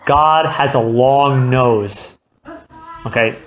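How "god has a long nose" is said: slow proverb. Shown as a demonstration. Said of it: "there's a phrase in Hebrew".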